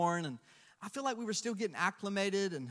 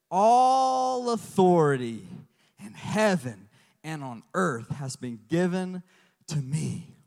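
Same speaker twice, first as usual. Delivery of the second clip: slow, drawn-out solemn reading; solemn scripture; 3 semitones lower, 5 dB louder, slower than usual. Acted. Preacher quoting Jesus's words, solemn slow.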